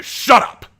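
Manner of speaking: sudden high, loud yell; furious shout. Acted. Asher shouting Abraham down in rage. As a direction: explode at him.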